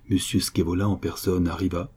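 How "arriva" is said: ordinary delivery, nothing marked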